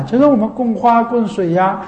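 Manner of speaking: usual speaking voice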